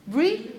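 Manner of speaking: "Bri-" punched high and loud, cut off before the ending; loud, rising "Bri-", stopping short of the weak syllable; stressed syllable alone. Shown as a demonstration. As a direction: hit "Bri-" hard with a sharp upward lift. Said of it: "the stressed syllable, first one".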